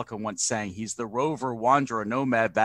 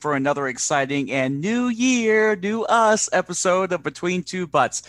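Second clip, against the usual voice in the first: big ringmaster announcer call; booming kids show opener; 5 semitones higher, 6 dB louder than usual. Acted. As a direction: boom it out like a circus host. Podcast host opening announcer intro.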